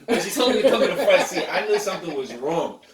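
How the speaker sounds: loud laughing retelling; laughing high and loud. Real, not acted. Laughing recalling the setup.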